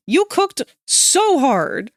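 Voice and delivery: slow, drawn-out cheering; emphatic praise. Real, not acted. Praising the artist, slow excited cheer. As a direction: stretch every word in praise.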